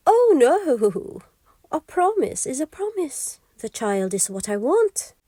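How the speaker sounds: a triumphant little imp refusing; gleeful imp. Acted. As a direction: imp gloating over the queen's offer.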